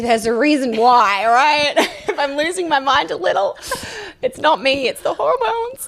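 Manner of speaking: playful laughing, pitch climbing high. Real, not acted. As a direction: giggle through the punchline. Laughing joke about acting crazy.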